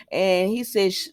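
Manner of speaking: ordinary talk, nothing marked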